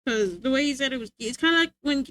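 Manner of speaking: normal talking voice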